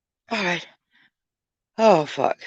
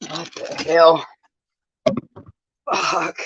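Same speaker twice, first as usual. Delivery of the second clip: usual speaking voice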